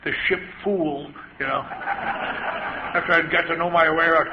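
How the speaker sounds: normal talking voice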